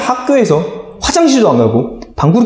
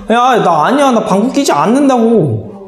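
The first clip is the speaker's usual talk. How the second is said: put-on protesting teen; 8 semitones higher than usual. Teen protesting to teasing classmates, high whiny denial. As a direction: protest your innocence, whining. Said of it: "막 이랬어".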